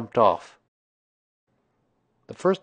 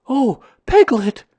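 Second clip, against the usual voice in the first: high, loud, drawn-out greeting; excited bear voice, pitched way up; Pooh, excited; 9 dB louder than usual. A narrator's reading. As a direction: burst in with big news. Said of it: "said Pooh excitedly".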